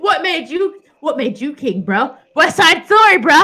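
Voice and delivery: high, loud, laughing bro challenge; fake tough guy swagger. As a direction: puff up like a cartoon tough kid. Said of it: "I'm quoting South Park".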